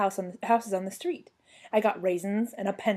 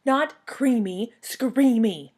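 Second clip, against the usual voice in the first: louder, slow stress on "Creamy" and "Screamy"; hollered correction; 4 semitones higher, 6 dB louder, much slower than usual. A narrator's reading. Child clown correcting her misheard name, hollering "Screamy". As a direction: holler "Not Creamy, Screamy", stretching both names. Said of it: "I hollered".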